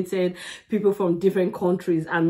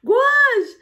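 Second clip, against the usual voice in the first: awed exclamation; 5 dB louder than usual. Real, not acted. Reacting to a powerful singer.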